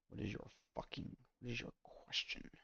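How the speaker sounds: whispering the show's line to himself; breathy hushed repeat of the question. Retold or imitated. Fan whispering a show quote to himself, whispering.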